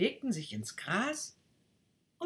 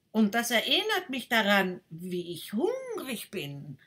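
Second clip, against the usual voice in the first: louder duck, slowing at the end; a duck thinking of food; 6 semitones higher, 5 dB louder than usual. Acted. Duck tells the rocket she is hungry, louder and slowing.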